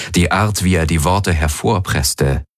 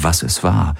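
plain reading voice

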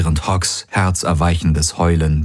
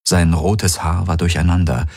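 ordinary delivery, nothing marked

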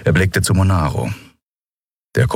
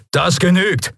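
sharp, much higher call to stop; 13 semitones higher than usual. Acted. Admiral stops the torture machine, sharp raised call. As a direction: cut them off firmly. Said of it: "rief Telios".

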